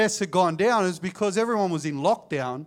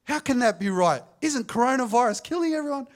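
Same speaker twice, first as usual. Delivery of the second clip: sarcastic mock; 7 semitones higher than usual. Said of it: "I go".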